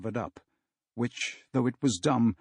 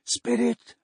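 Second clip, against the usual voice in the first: put-on higher, trembling old man calling the spirit; shuddering Scrooge; 11 semitones higher than usual. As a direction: say it as if shaking head to foot. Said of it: "shuddering from head to foot".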